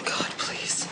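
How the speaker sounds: soft, desperate whisper to God; whispered plea. Real, not acted. Alone in her shelter, begging God in a whisper. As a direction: breathe the prayer, barely voiced. Praying as tornado approaches.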